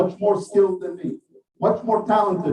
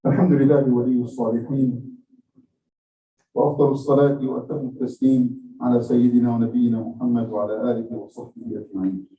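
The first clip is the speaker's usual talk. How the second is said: fast, lower liturgical Arabic formula, run together; rapid Arabic recitation; 6 semitones lower, much faster than usual. Acted. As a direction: rattle off the closing prayer formula quickly. Closing Arabic sermon formula.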